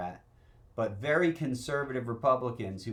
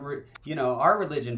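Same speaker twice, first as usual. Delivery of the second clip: normal talking voice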